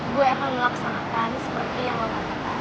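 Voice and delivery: mock-obedient, dropping low and soft; put-on sweetness. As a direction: play the sweet, obedient one a little. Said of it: "Lo sok manis gitu". (sok manis)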